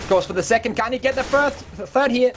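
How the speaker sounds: rising excited play-by-play; esports caster. Acted. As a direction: build the call as the clutch unfolds. Live clutch play-by-play.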